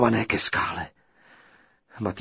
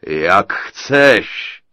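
chuckling giant; 12 dB louder than usual. A narrator's reading. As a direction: toss it off with a cackle. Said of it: "zachechtal se obr". (obr, posměšně se chechtá)

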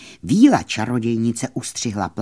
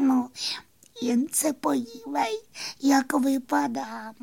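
very high, softer fairy sigh; a tiny sad fairy sighing it out; 5 dB quieter than usual. Acted. Fairy shows the moon her cut hair, high sighing voice. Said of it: "vzdychla plavůňka".

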